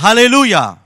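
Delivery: Halleluja called out high and loud as the lead prompt. Retold or imitated. Preacher leading the deaf boy, calling Halleluja loudly. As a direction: belt out Halleluja for the boy to repeat. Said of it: "Haben wir gesagt".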